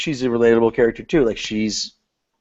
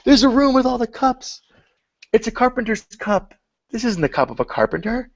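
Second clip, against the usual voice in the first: very high, mock-amazed squeal; hammed-up wonder; 12 semitones higher than usual. Teasing co-host about the room full of cups, mock amazed. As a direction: put on exaggerated amazement, way up in pitch. Mimicking a reaction to the cup scene.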